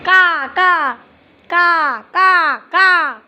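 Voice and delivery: repeated, drawn-out raven caws; raven cawing. Pretend. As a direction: imitate a raven's call. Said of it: "It sounds like a raven".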